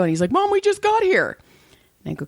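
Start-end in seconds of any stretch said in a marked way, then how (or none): 0.3-1.4 put-on high-pitched complaining child; imitating an outraged son; mock kid protest. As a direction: protest like a kid dragged away early. Retold child protesting leaving.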